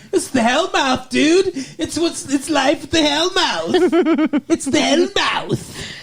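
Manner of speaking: hammed-up parody of her stock answer, breaking into a laugh; mocking my fiancée. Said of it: "you're like".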